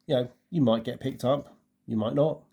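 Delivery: ordinary talk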